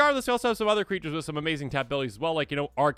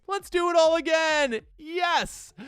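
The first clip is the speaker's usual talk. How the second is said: much higher, gleeful cheer; 13 semitones higher, 4 dB louder, slower than usual. Real, not acted. Player cheering the combo on, gleeful.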